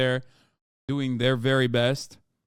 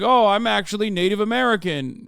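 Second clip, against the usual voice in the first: mocking the claim in a higher, louder voice; 6 semitones higher, 5 dB louder than usual. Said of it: "they'll be like".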